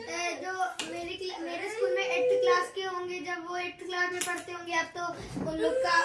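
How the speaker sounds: usual speaking voice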